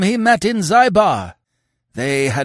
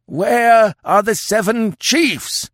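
put-on slow, high, loud demand of an old chief; drawn-out high-pitched wail of rage; howled; 14 semitones higher, 5 dB louder, slower than usual. A narrator's reading. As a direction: howl the question in fury. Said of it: "howled the old chief".

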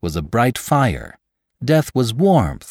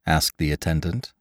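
plain reading voice

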